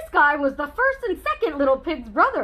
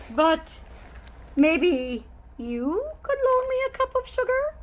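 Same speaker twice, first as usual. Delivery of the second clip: sweet asking; 3 semitones higher, 5 dB quieter than usual. A narrator's reading. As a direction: coax the listener sweetly. Wolf sweetly asking reader.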